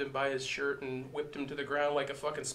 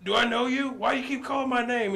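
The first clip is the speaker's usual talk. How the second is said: put-on drunk confused; 8 semitones higher than usual. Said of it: "He's like".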